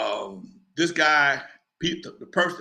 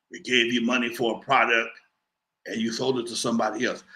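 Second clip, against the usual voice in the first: a much lower voice for the caller; menacing caller; 7 semitones lower than usual. Retold or imitated. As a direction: speak down low like a Jersey heavy making a threat. Caller accuses him.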